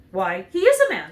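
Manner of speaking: a lightly voiced little man, firm; the other Munchkin. A narrator's reading. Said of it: "said the other".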